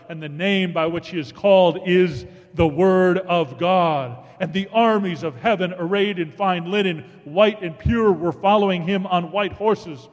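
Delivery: thundering preacher reading Revelation; preaching at full force. Acted. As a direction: let it rise to a triumphant climax. Climactic scripture reading.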